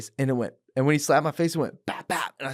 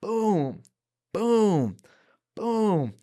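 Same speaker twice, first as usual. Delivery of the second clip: punch sounds; 8 semitones higher, much slower than usual. Retold or imitated.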